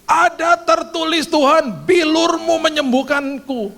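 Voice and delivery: slow, high, loud cry of faith; desperate shout. Retold or imitated. A sick man shouting scripture at his fever, slow and loud. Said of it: "Aku teriak".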